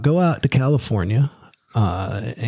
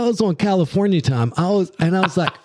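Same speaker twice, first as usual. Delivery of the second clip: ordinary delivery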